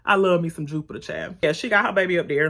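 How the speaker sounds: ordinary delivery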